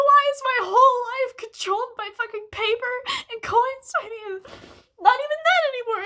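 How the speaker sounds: sobbing out the frustration, voice cracking; crying. Real, not acted. Crying rant about money.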